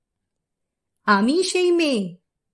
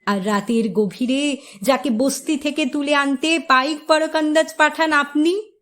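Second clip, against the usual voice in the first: normal talking voice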